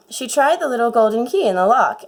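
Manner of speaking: ordinary delivery, nothing marked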